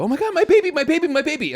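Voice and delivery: very high, loud mock scream; panicked mother. Retelling a mother's panic in the hotel, high shriek. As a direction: shriek for the falling baby.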